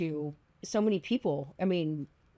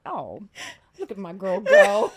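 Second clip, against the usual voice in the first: proud mom gush; 7 dB louder than usual. Retold or imitated. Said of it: "It's like a proud mom moment".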